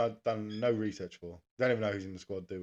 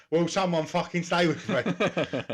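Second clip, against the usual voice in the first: put-on slow, pleading London accent; 5 semitones higher, slower than usual. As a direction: beg your partner, London-style. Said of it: "Maybe not in that London accent".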